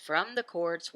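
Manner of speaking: normal talking voice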